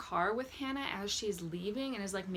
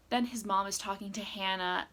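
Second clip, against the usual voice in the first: ordinary talk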